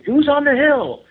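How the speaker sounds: imitating a call. Matt calling up the hill a third time, high loud insistent call.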